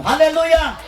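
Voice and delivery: high, ringing hallelujah cry; shouted hallelujah. Real, not acted. Preacher to congregation, a ringing hallelujah shout. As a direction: call it out to the crowd.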